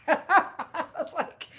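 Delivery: slow, laughing through the words. Retold or imitated. Laughing to viewers about never going back to Catalina. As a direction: chuckle it out defiantly.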